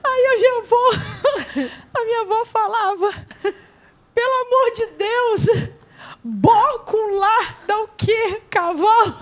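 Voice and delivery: high, far, laugh-choked voice; real laughter; laughing through words. Real, not acted. Retelling grandmother while laughing.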